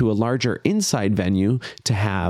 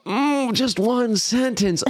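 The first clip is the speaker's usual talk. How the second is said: mock dismay; 12 semitones higher, slower than usual. Mock dismay at one-sentence limit.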